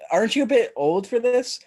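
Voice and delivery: put-on high voice questioning a trick-or-treater; doubtful candy giver. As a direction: play a sceptical neighbour in a high voice. Plays adult handing out candy.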